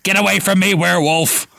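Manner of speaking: Ron gasping. A narrator's reading. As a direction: recoil and blurt it out. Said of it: "Ron gasped".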